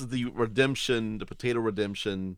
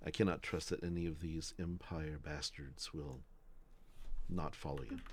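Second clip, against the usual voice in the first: softer, confidential captain's warning; leaning in confidentially; 3 semitones lower, 12 dB quieter than usual. Acted. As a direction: lean in and lower your volume, as if sharing a warning. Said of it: "he kinda leans in and says".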